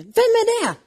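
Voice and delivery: high, startled demand; the king jumps up in alarm; high-pitched who-is-it outburst; shocked question. Acted.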